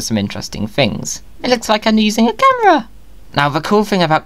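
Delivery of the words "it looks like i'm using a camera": excited. Real, not acted. Excited high comment.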